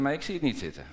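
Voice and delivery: usual speaking voice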